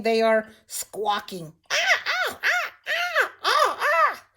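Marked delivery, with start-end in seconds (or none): 1.7-4.2 high, harsh cawing crow calls; crow squawks. Retold or imitated. Teacher imitating crows fighting over something, high cawing. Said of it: "crows out there fighting".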